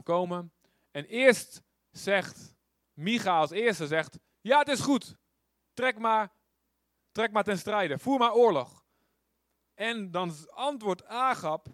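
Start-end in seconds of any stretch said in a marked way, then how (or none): 4.4-8.9 loud, high mock approval; sarcastic prophet. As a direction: egg him on with a sneer. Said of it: "misschien zegt Micha het heel sarcastisch". (spottend, luid aanmoedigend)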